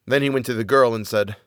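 ordinary delivery, nothing marked

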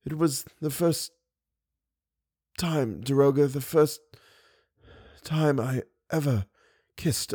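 a dying man lost in memory; weak, dying confession. Acted. Eric confesses love, dying.

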